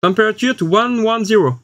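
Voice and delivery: English command with a French accent. Real, not acted. Reviewer giving the machine a temperature command, French-accented English. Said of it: "elle a compris ton accent".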